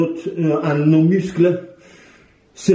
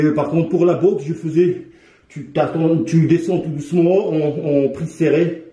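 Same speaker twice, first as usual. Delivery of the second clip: ordinary talk, nothing marked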